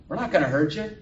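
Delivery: acted loud reassurance; loud protest. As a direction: call it out to a nervous newcomer. Same yelling customer reassures her.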